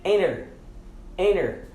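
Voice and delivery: "enter" said like "enner"; silent T. Shown as a demonstration. Teacher gives the example "enter" with silent T. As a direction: drop the T of "enter" after the N. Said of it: "nós vamos silenciar esse T".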